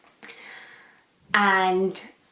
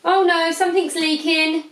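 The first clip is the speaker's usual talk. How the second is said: sudden alarm; 10 semitones higher, 4 dB louder than usual. Real, not acted. Notices a leak on sofa.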